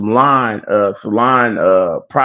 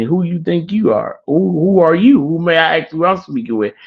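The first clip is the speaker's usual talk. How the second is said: higher, mock-snippy questioning; nasty challenge; 7 semitones higher than usual. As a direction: act out insolent backtalk to a stranger. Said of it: "like, nasty with him".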